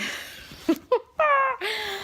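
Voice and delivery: high, wet laugh through tears; real nervous laugh; teary laugh. Real, not acted. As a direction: laugh at yourself while welling up. Laughs at trying not to cry.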